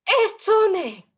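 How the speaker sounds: loud, high astonished cry; hammed-up astonishment; acted surprise. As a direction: exclaim it as if amazed. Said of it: "I'm surprised".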